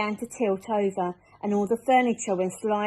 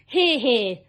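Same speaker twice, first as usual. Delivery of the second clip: a louder cheer of agreement; cheering shout; 3 semitones higher, 6 dB louder than usual. Acted. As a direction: cheer them on together. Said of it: "they all shouted".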